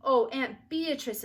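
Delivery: slow, loud, high "oh" of delight; delighted exclamation. A narrator's reading. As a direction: gush over the present. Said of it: "exclaimed Beezus".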